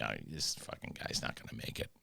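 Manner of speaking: low, very soft muttering; quiet imagined viewer's aside; hushed aside. Retold or imitated. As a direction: mutter it under your breath.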